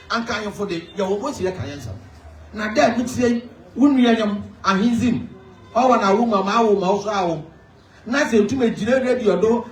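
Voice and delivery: a chief rousing his people in Fante; impassioned public speech. Acted.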